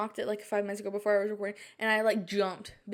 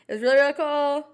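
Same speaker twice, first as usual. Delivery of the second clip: excited; 5 semitones higher, 7 dB louder than usual. Real, not acted. Repeats line with more energy.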